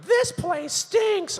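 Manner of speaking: very high, slow squeaky cartoon skunk. Skunk turns to the comedian, very high cartoon voice. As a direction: drag it out like a cartoon critter. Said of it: "turned to me and went".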